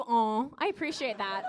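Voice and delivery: ordinary talk, nothing marked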